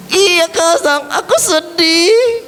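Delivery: put-on tearful complaint; tearful puppet. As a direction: whine it as a weeping puppet. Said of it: "Kenapa kamu kok nangis?".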